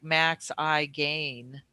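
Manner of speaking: Max I Gain, drawn out slowly word by word; slow, separated Max... I... Gain; name split into words. Shown as a demonstration. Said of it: "but it's, like".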